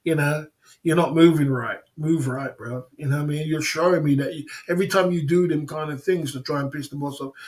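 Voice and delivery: ordinary delivery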